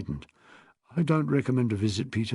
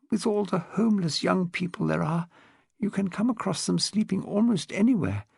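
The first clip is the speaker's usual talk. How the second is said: put-on higher, refined elderly upper-class voice; posh old lady; 5 semitones higher than usual. Old lady tells Rose about her husband, posh elderly voice. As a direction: speak like a frail, well-bred widow of seventy.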